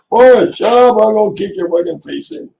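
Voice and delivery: high, loud, fast threat; exasperated high-pitched shouting; angry yell. Real, not acted. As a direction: yell at him to shut up. Yells threat at Boris.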